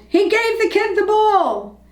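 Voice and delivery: high-pitched, jubilant narrated yell; excited yell. A narrator's reading. Somebody in the crowd announcing the news, excited yell. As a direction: call it out high, like a thrilled fan. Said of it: "Somebody yelled".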